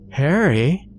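high, rising call of his name; calling wife. A narrator's reading. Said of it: "Meredith called to him".

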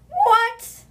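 loud, high-pitched disbelief; astonished. Real, not acted. Reader reacts to the huge bequest, loudly astonished. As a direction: shriek it, then stretch out the amount.

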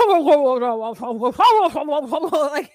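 loud, rolling mock guffaw; Muppets. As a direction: heckle from the box with a long, hammed-up old-man laugh. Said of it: "he looks like the Muppets".

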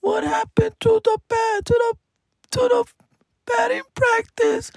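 mock kid voice of Nicolas; whiny kid voice. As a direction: imitate a disappointed child whining. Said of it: "Nicolas was like".

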